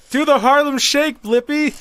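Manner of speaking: high, loud, slow taunt; heckling. Pretend. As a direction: drag it out to taunt from the crowd. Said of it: "heckle the fuck out of him".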